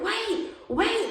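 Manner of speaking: high-pitched call after them; yelling for them to wait; calling up the street. Retold or imitated. Yelling up the street after the girls. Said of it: "I'm screaming up the street".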